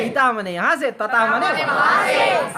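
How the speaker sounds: ईता मने यहाँ से plain, then तता मने वहाँ से slow and high; sing-song drill. Shown as a demonstration. Teacher drills class on Sanskrit adverbs, chanting. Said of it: "teacher drilling words aloud".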